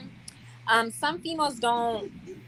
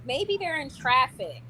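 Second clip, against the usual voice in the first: ordinary delivery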